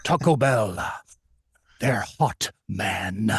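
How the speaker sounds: raspy, breathy voiceover with long pauses; slow rasp trailing into heavy breath; Jack Palance impression. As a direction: mimic a menacing old star's ad read, word by word. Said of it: "Taco Bell was using him as a voiceover".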